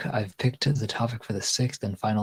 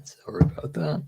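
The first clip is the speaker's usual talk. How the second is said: breathy whispered apology; 6 semitones higher than usual. Real, not acted. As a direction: whisper the sorry under your breath. Apologising quietly between lines.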